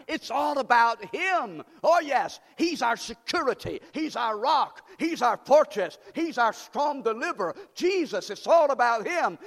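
loud, ringing preacher climax; shouting the sermon's high point; preaching at full force. Acted. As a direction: build the praise up loud and strong.

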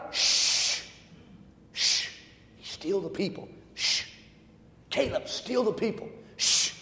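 soft shushing between the words; soft, playful shh. Acted. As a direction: hush them gently. Hushing the congregation playfully.

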